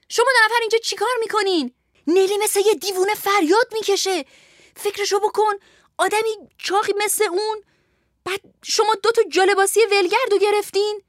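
high, agitated guard's outcry; alarmed shouting. Acted. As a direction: burst in crying out in alarm. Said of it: "به داخل اتاق دوید و فریاد زد". (فریاد هراسان با صدای زیر)

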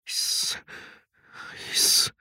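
short hissed shush; hushing. A narrator's reading. Parent silencing the child in hiding, hushing. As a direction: shush softly, under the breath.

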